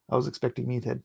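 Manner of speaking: normal talking voice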